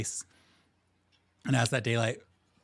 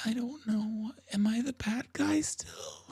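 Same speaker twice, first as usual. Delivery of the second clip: high, thin, slow character voice; put-on high voice fading to breathy; confused actor; 12 semitones higher than usual. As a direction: wonder aloud, dim and hesitant.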